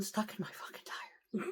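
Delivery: hushed whisper; whispered reveal. Real, not acted. Trucker revealing the bolt, hushed whisper. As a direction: share the shocking find under your breath. Aside while telling.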